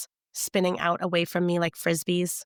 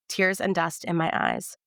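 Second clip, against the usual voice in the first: ordinary delivery, nothing marked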